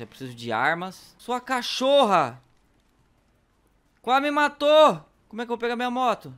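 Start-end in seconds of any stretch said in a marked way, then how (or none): 1.2-6.3 high outraged yelling; furious shout. Real, not acted. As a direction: scream at the one who nearly killed you. Nearly killed, yelling.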